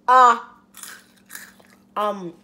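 speaking as food goes in; about to eat. Real, not acted. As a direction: mouth opening for food. Seen 1: Tube only held by chin; orange snack enters open mouth at end.

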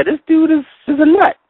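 put-on high, scornful young voice; mocking kids. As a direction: kids laughing off the Pharaoh, lightly voiced. Kids now mocking the Pharaoh.

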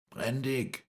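high-pitched call of a name. A narrator's reading. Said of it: "rief Moreau". (hoch gerufen)

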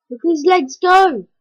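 loud, high amazed shout; loud gleeful cry at the game; excited shout. Real, not acted. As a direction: shout with glee at the flailing legs. Excited at ragdoll legs.

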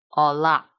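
"la" 读得更重，作为重读音节; 把重音落在 "la" 上; 重音示范. Shown as a demonstration. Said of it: "把它重音落在这个音节上". (重读 "la" 这个音节)

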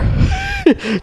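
high squealing laugh; a sudden shrill laugh; burst of laughter. Real, not acted. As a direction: cracks up at his own joke. Laughs at the ice cream bar.

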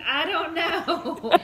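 words through helpless laughter; laughing. Real, not acted. Wife laughing while retelling the ice cream talk. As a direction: laugh it out, slow.

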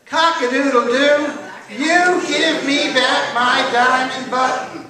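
slow, loud, high crowing chant; drawn-out sing-song rooster demand; rooster call-and-response. A narrator's reading. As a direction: lead the audience in the rooster's crow. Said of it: "Now this is your part".

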